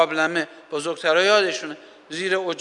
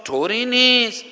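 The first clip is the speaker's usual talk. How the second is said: slow, higher reassuring "طوری نیست" stretched out; calming command; 7 semitones higher, 3 dB louder than usual. Shown as a demonstration. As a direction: tell yourself "طوری نیست" gently and slowly. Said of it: "فرمانهای آرامش دهنده".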